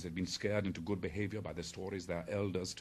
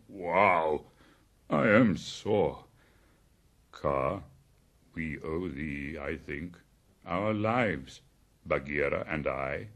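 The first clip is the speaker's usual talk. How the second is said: sore bear; 3 semitones lower, 6 dB louder, slower than usual. A narrator's reading. Sore bear thanks the python.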